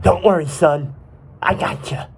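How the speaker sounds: rough soldier voice, taking charge; gruff military rescuer. Acted. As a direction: reassure like a tough old soldier. Soldier Microglia comes to rescue.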